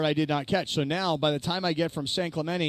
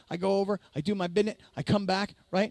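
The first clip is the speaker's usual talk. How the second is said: ordinary delivery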